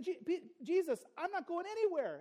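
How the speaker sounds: high-pitched, earnest protest of loyalty; bold, earnest pledge. Acted. Preacher voices Peter pledging loyalty to Jesus, bold and earnest. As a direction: declare it boldly, voice lifted high. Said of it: "It was a bold, uh, proclamation of loyalty".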